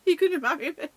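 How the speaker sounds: high, loud question through laughter; laughing retold proposal. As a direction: squeal the repeated question, amused. Repeated proposal, amid her laughter.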